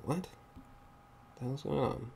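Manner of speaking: ordinary talk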